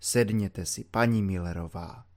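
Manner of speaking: low, soft and mysteriously solemn; quiet solemn voice. A narrator's reading. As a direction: speak low like sharing a grave secret. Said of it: "takovým tichým hlasem". (tichý, tajuplně slavnostní hlas)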